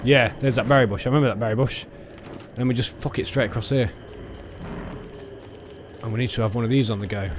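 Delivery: ordinary delivery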